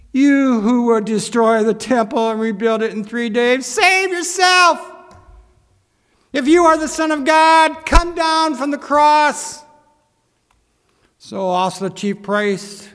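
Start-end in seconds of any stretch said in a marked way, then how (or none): 0.1-9.6 loud, raised jeer building to a taunt; derisive. Acted. Passers-by deriding Jesus on the cross, loud and mocking. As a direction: jeer at him, stretching the final challenge. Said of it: "derided him, wagging their heads and saying".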